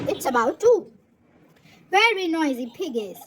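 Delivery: ordinary delivery, nothing marked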